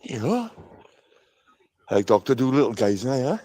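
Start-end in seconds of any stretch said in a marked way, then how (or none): 0.1-0.5 pet talk. Real, not acted. Cooing to donkeys.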